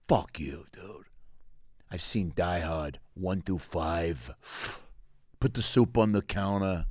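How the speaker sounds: low, slow, quiet and world-weary; smoking toddler. Acted. Toddler smoker dismissing the peekaboo player, low and jaded. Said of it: "like he was smoking a cigarette".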